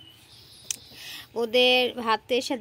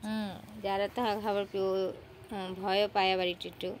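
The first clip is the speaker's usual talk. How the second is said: ordinary talk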